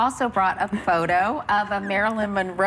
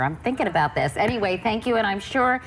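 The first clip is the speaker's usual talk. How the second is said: normal talking voice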